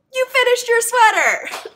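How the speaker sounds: high, loud thrilled exclamation; imitating an overjoyed friend; delighted squeal. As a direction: squeal it like a friend's big reveal. Chelsea's delighted outburst retold.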